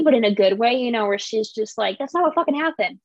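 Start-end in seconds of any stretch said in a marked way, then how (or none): 2.0-3.0 snappy and defiant, quick; sassy. Retold or imitated. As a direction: fire it back with attitude. Acted quote of spicy Sydney.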